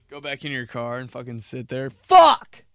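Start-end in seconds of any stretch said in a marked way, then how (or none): 1.9-2.6 much louder, higher swear; loud outburst. Retold or imitated. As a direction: yell it out. Frustrated outburst.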